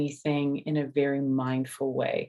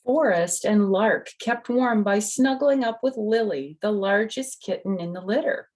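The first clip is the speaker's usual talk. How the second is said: lively, raised storybook voice; reading to children; 5 semitones higher, 4 dB louder than usual. A narrator's reading. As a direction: tell it like a bedtime story for little ones. Reads the picture book aloud.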